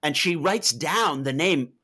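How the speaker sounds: normal talking voice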